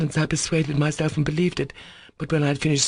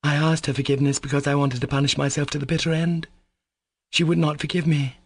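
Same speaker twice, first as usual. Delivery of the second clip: unnatural voice; 6 semitones higher than usual. A narrator's reading. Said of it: "she added in an unnatural voice".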